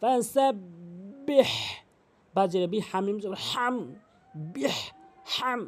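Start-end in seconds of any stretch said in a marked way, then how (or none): 0.0-1.5 ফাসাব্বিহ slow, ending in a strong throat h; big haa. Shown as a demonstration. Teacher demonstrates big haa, careful slow ফাসাব্বিহ. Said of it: "বড় হায়নে উচ্চারণ করতে হবে". 4.5-5.6 বিহ and হাম with a strong throat h; big haa. Shown as a demonstration. Teacher shows this way of saying it, big haa. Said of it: "এভাবে".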